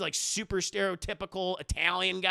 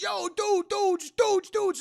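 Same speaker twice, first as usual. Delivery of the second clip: high urgent calling out; 12 semitones higher, 5 dB louder, slower than usual. Retold or imitated. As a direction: burst in calling the dudes. Guy bursts into room.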